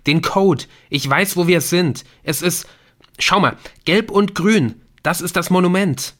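high, loud, bursting with it; excited discovery. A narrator's reading. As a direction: blurt out what you have figured out. Nick excitedly explains his discovery. (aufgeregt, hoch und laut)

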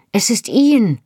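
loud high call across the yard. Acted. Jenny calls to Claire that Ian is coming, loud and high. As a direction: call it out in alarm. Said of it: "rief sie mir zu".

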